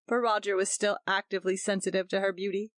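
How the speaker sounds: ordinary delivery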